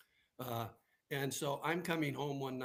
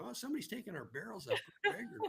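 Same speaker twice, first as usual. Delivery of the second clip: higher, fast laughing report; a light mock of a chuckling neighbor; amused neighbor; 10 semitones higher, faster than usual. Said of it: "And they go".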